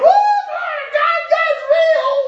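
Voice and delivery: very high, slow, swooning cry of revelation; overwhelmed mama. Retold or imitated. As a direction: play a mother overcome by the spirit. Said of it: "so Mama's going".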